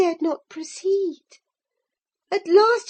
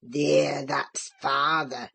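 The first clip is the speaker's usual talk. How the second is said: put-on much lower, slow old Yorkshire croak; croaking Joseph; 6 dB quieter, slower than usual. A narrator's reading. Old Joseph mocks the frantic boy, low slow croak. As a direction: savour it with a mocking chuckle. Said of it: "his croaking laugh".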